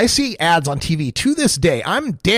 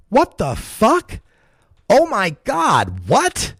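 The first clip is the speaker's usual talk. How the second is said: stunned disbelief; 5 semitones higher, much slower than usual. Real, not acted. Reacting to the Tumblr post.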